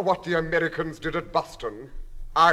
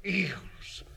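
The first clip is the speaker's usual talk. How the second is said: disgusted whisper; 4 semitones higher, 6 dB quieter than usual. Acted. Husband to wife, a disgusted whisper. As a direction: whisper Eels! with a shudder.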